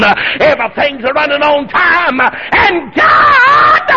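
high, loud, ringing preacher shout; full-force preaching. Real, not acted. Preacher shouting joy to the church. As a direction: preach it with everything.

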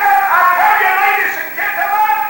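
high, shouted soapbox oratory; loud, drawn-out speechmaking; imitated public harangue. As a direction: holler slowly to a crowd in the park. Imitates communist haranguing a park.

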